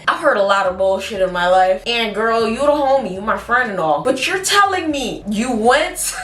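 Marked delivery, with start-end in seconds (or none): none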